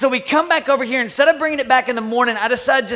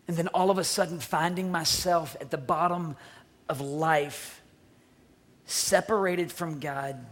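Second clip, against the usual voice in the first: low, soft, with long pauses; solemn reflective preaching; 5 semitones lower, 10 dB quieter than usual. Real, not acted. Preacher relates the story to spiritual walks, solemnly. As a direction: bring it down hushed and measured.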